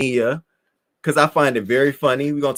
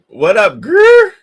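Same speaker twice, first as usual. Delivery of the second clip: high, loud squealed hello; loud delighted shout-out; excited greeting; 9 dB louder, much slower than usual. Real, not acted. Greeting viewer on live.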